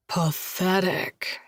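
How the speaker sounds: drawn-out playful put-down; slow mock scorn. Josh mock-scorns her weak slap, slow teasing. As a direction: tease her, slowly.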